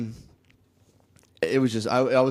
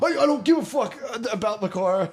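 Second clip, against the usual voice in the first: high-pitched mock indignation; worked-up retort; 10 semitones higher, 3 dB louder than usual. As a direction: re-enact an angry comeback, voice pitched way up. Acts out his furious reply to taunt.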